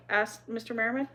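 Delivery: ordinary delivery, nothing marked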